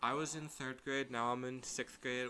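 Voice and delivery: soft, low-key young hero, lightly voiced; a hushed, earnest boy hero; slowing into a quiet, solemn speech; Mega Man voice. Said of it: "he talks like this".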